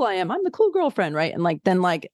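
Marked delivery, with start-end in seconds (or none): 0.3-1.1 mock cool girlfriend. Mocking cool girlfriend persona.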